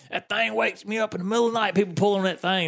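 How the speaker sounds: high-pitched aggrieved complaint; worked-up mock grievance; big mad retelling. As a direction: imitate an irate man griping. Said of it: "he was big mad".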